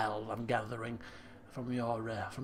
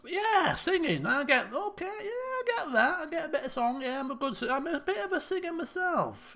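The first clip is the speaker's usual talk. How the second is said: much higher, strained, laughing boast; squeaky mock bravado; 11 semitones higher than usual. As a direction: crow about singing in a squeaky voice. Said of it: "It's, it's questionable".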